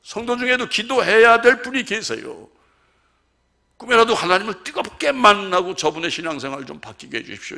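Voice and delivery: high, loud pulpit prayer; fervent preaching. Acted. Pastor praying aloud for a member, fervent. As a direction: plead loudly from the pulpit.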